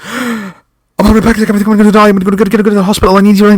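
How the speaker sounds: high, loud, breathless panic; like a horse race commentator. Retold or imitated. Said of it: "commentating on a race, almost, a horse race".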